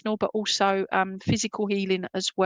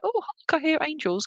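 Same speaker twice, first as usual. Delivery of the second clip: higher, airy, wide-eyed mock wonder; mock dreamy; 6 semitones higher than usual. Medium mocking an airy angel vision, much higher. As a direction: send up a swooning angel-spotter.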